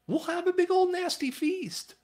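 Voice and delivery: high, eager coaxing as the father; a hammed-up, high-pitched father pleading with his son. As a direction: sing it up high to coax the son in. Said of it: "He's like".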